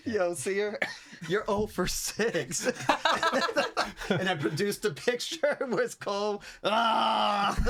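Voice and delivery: high-pitched, helpless laughter through the words; laughing hard. Real, not acted. Player ribs the Seer over failed guesses, laughing hard. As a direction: crack up while getting the words out.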